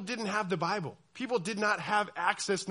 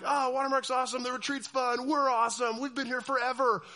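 a touch higher, mock-proud teen; smug youth group kid; 6 semitones higher than usual. Pastor mimicking students bragging about Watermark, mild mock. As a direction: lightly tease their church pride. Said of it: "this church that you're like".

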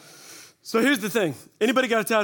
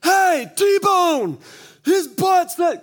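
high, loud holler across the room; 12 semitones higher, 7 dB louder, much slower than usual. Retold or imitated. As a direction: yell it out to the whole room. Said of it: "they're just hollering".

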